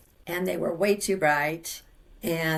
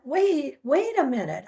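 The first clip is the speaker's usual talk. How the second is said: high-pitched alarmed protest; re-enacted panic, pitched well up; retold freak-out; 8 semitones higher than usual. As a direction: halt everything in confusion. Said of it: "I'm freaking out".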